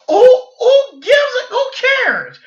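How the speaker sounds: high, loud, stretched exasperated shout; exasperated outburst. Real, not acted. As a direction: throw your hands up, who cares. Exasperated outburst about rivals.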